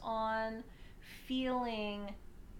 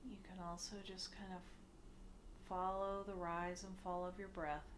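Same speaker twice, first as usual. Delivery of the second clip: soft meditation voice with long pauses, growing quieter; hushed, unhurried guiding, sinking softer as it goes; guided meditation; 3 semitones lower, 10 dB quieter than usual. Acted. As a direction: ease them gently into stillness.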